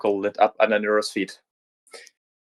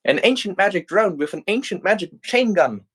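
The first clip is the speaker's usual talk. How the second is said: high, bubbling giddy voice; giddy excitement; 9 semitones higher than usual. Acted. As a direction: gush like a kid with a new toy. Giddier over the drone.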